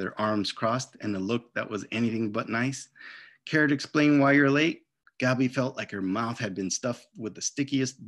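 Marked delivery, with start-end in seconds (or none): 3.5-4.9 stern teacher. Acted. Stern teacher to late pupil.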